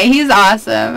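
usual speaking voice

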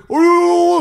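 the vendor shouting after tourists in the rain, loud mock yell; imitating a man yelling. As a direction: belt it out like the street vendor calling after them. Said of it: "והוא צועק".

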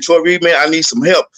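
ordinary talk

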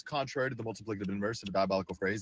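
rattled off fast; fast tongue twister. Shown as a demonstration. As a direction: race through the tongue twister. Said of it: "Do you know the one that goes".

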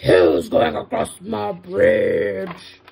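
low, loud, drawn-out bellow; troll's shout. Acted. Troll challenges the medium goat, low slow shout. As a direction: roar it slowly from down low. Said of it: "jumped out from under the bridge and shouted".

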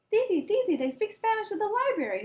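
put-on high, loud childish calling out; excited shout. A narrator's reading. Boy shouting news to his aunt, excited. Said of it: "Hildemar shouted".

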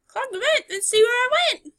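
high riddling voice; high sing-song voice. Acted. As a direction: chant it playfully. Voicing talking object.

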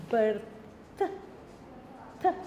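"birth" broken off, then an airy "Th"; isolated th. Shown as a demonstration. Teacher showing the class the th in "birth", over-articulated. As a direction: end "birth" with tongue between teeth on "Th". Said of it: "Look at my tongue".

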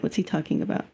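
ordinary talk

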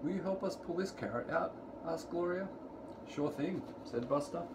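ordinary delivery

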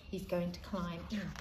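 ordinary delivery

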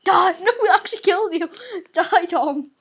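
mock attack yells, quick and laughing; playful battle cry. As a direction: shout it gleefully with a laugh. Mock battle with water guns.

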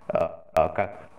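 normal talking voice

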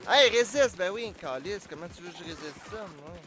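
loud frantic pleading at the game; panicking gamer. Real, not acted. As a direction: panic as the enemies swarm. Panicked under attack.

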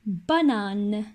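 ordinary talk

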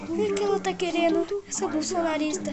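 ordinary talk

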